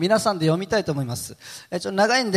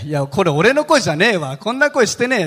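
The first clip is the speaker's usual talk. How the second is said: insistent denial, acted out louder; retold stubborn protest, pushed louder; uparte zaprzeczanie; 3 semitones higher, 4 dB louder than usual. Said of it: "めっちゃ言い張ったんですけど".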